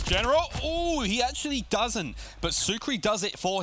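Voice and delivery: high, loud yell settling into an elevated call; high-pitched commentator excitement; excited shout, easing. Real, not acted. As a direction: cry out at the near miss, then report. Calling a clutch moment.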